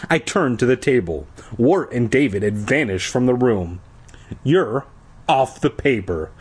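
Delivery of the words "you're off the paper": usual speaking voice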